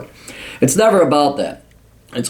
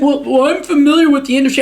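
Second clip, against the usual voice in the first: much higher, quick, defensive mock voice; mocking old hippie woman. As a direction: parody her flustered claim. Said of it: "She's like".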